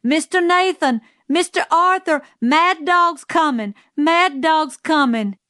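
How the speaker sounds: high-pitched, urgent shouting; shouted warning. A narrator's reading. Calpurnia warning the Radleys at their door, shouted. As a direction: call the alarm out toward a closed house. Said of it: "and she shouted".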